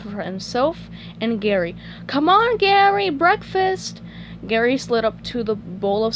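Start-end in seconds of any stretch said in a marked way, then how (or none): 2.1-4.0 high, eager call; SpongeBob calling out. Acted. Calling the pet snail to breakfast, high and eager. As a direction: sing out a high-pitched summons to the pet.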